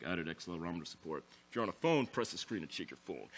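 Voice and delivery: low, soft deadpan announcement; all serious. Retold or imitated. Developer posting an update, all serious. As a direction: read it out like a dry, serious update note. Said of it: "I sound all serious".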